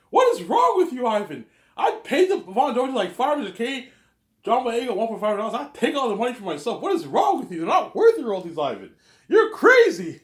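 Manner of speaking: high, loud rant of disbelief; mock outraged shouting. Berating Ivan over the actors' pay, loud and outraged. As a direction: yell it like a hammed-up scolding.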